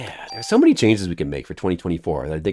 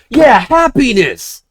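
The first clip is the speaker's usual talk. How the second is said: slow, high, louder cheer; exclaimed happiness; 14 semitones higher, 8 dB louder, much slower than usual. Shown as a demonstration. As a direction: savour the joy in it. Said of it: "How about just, like".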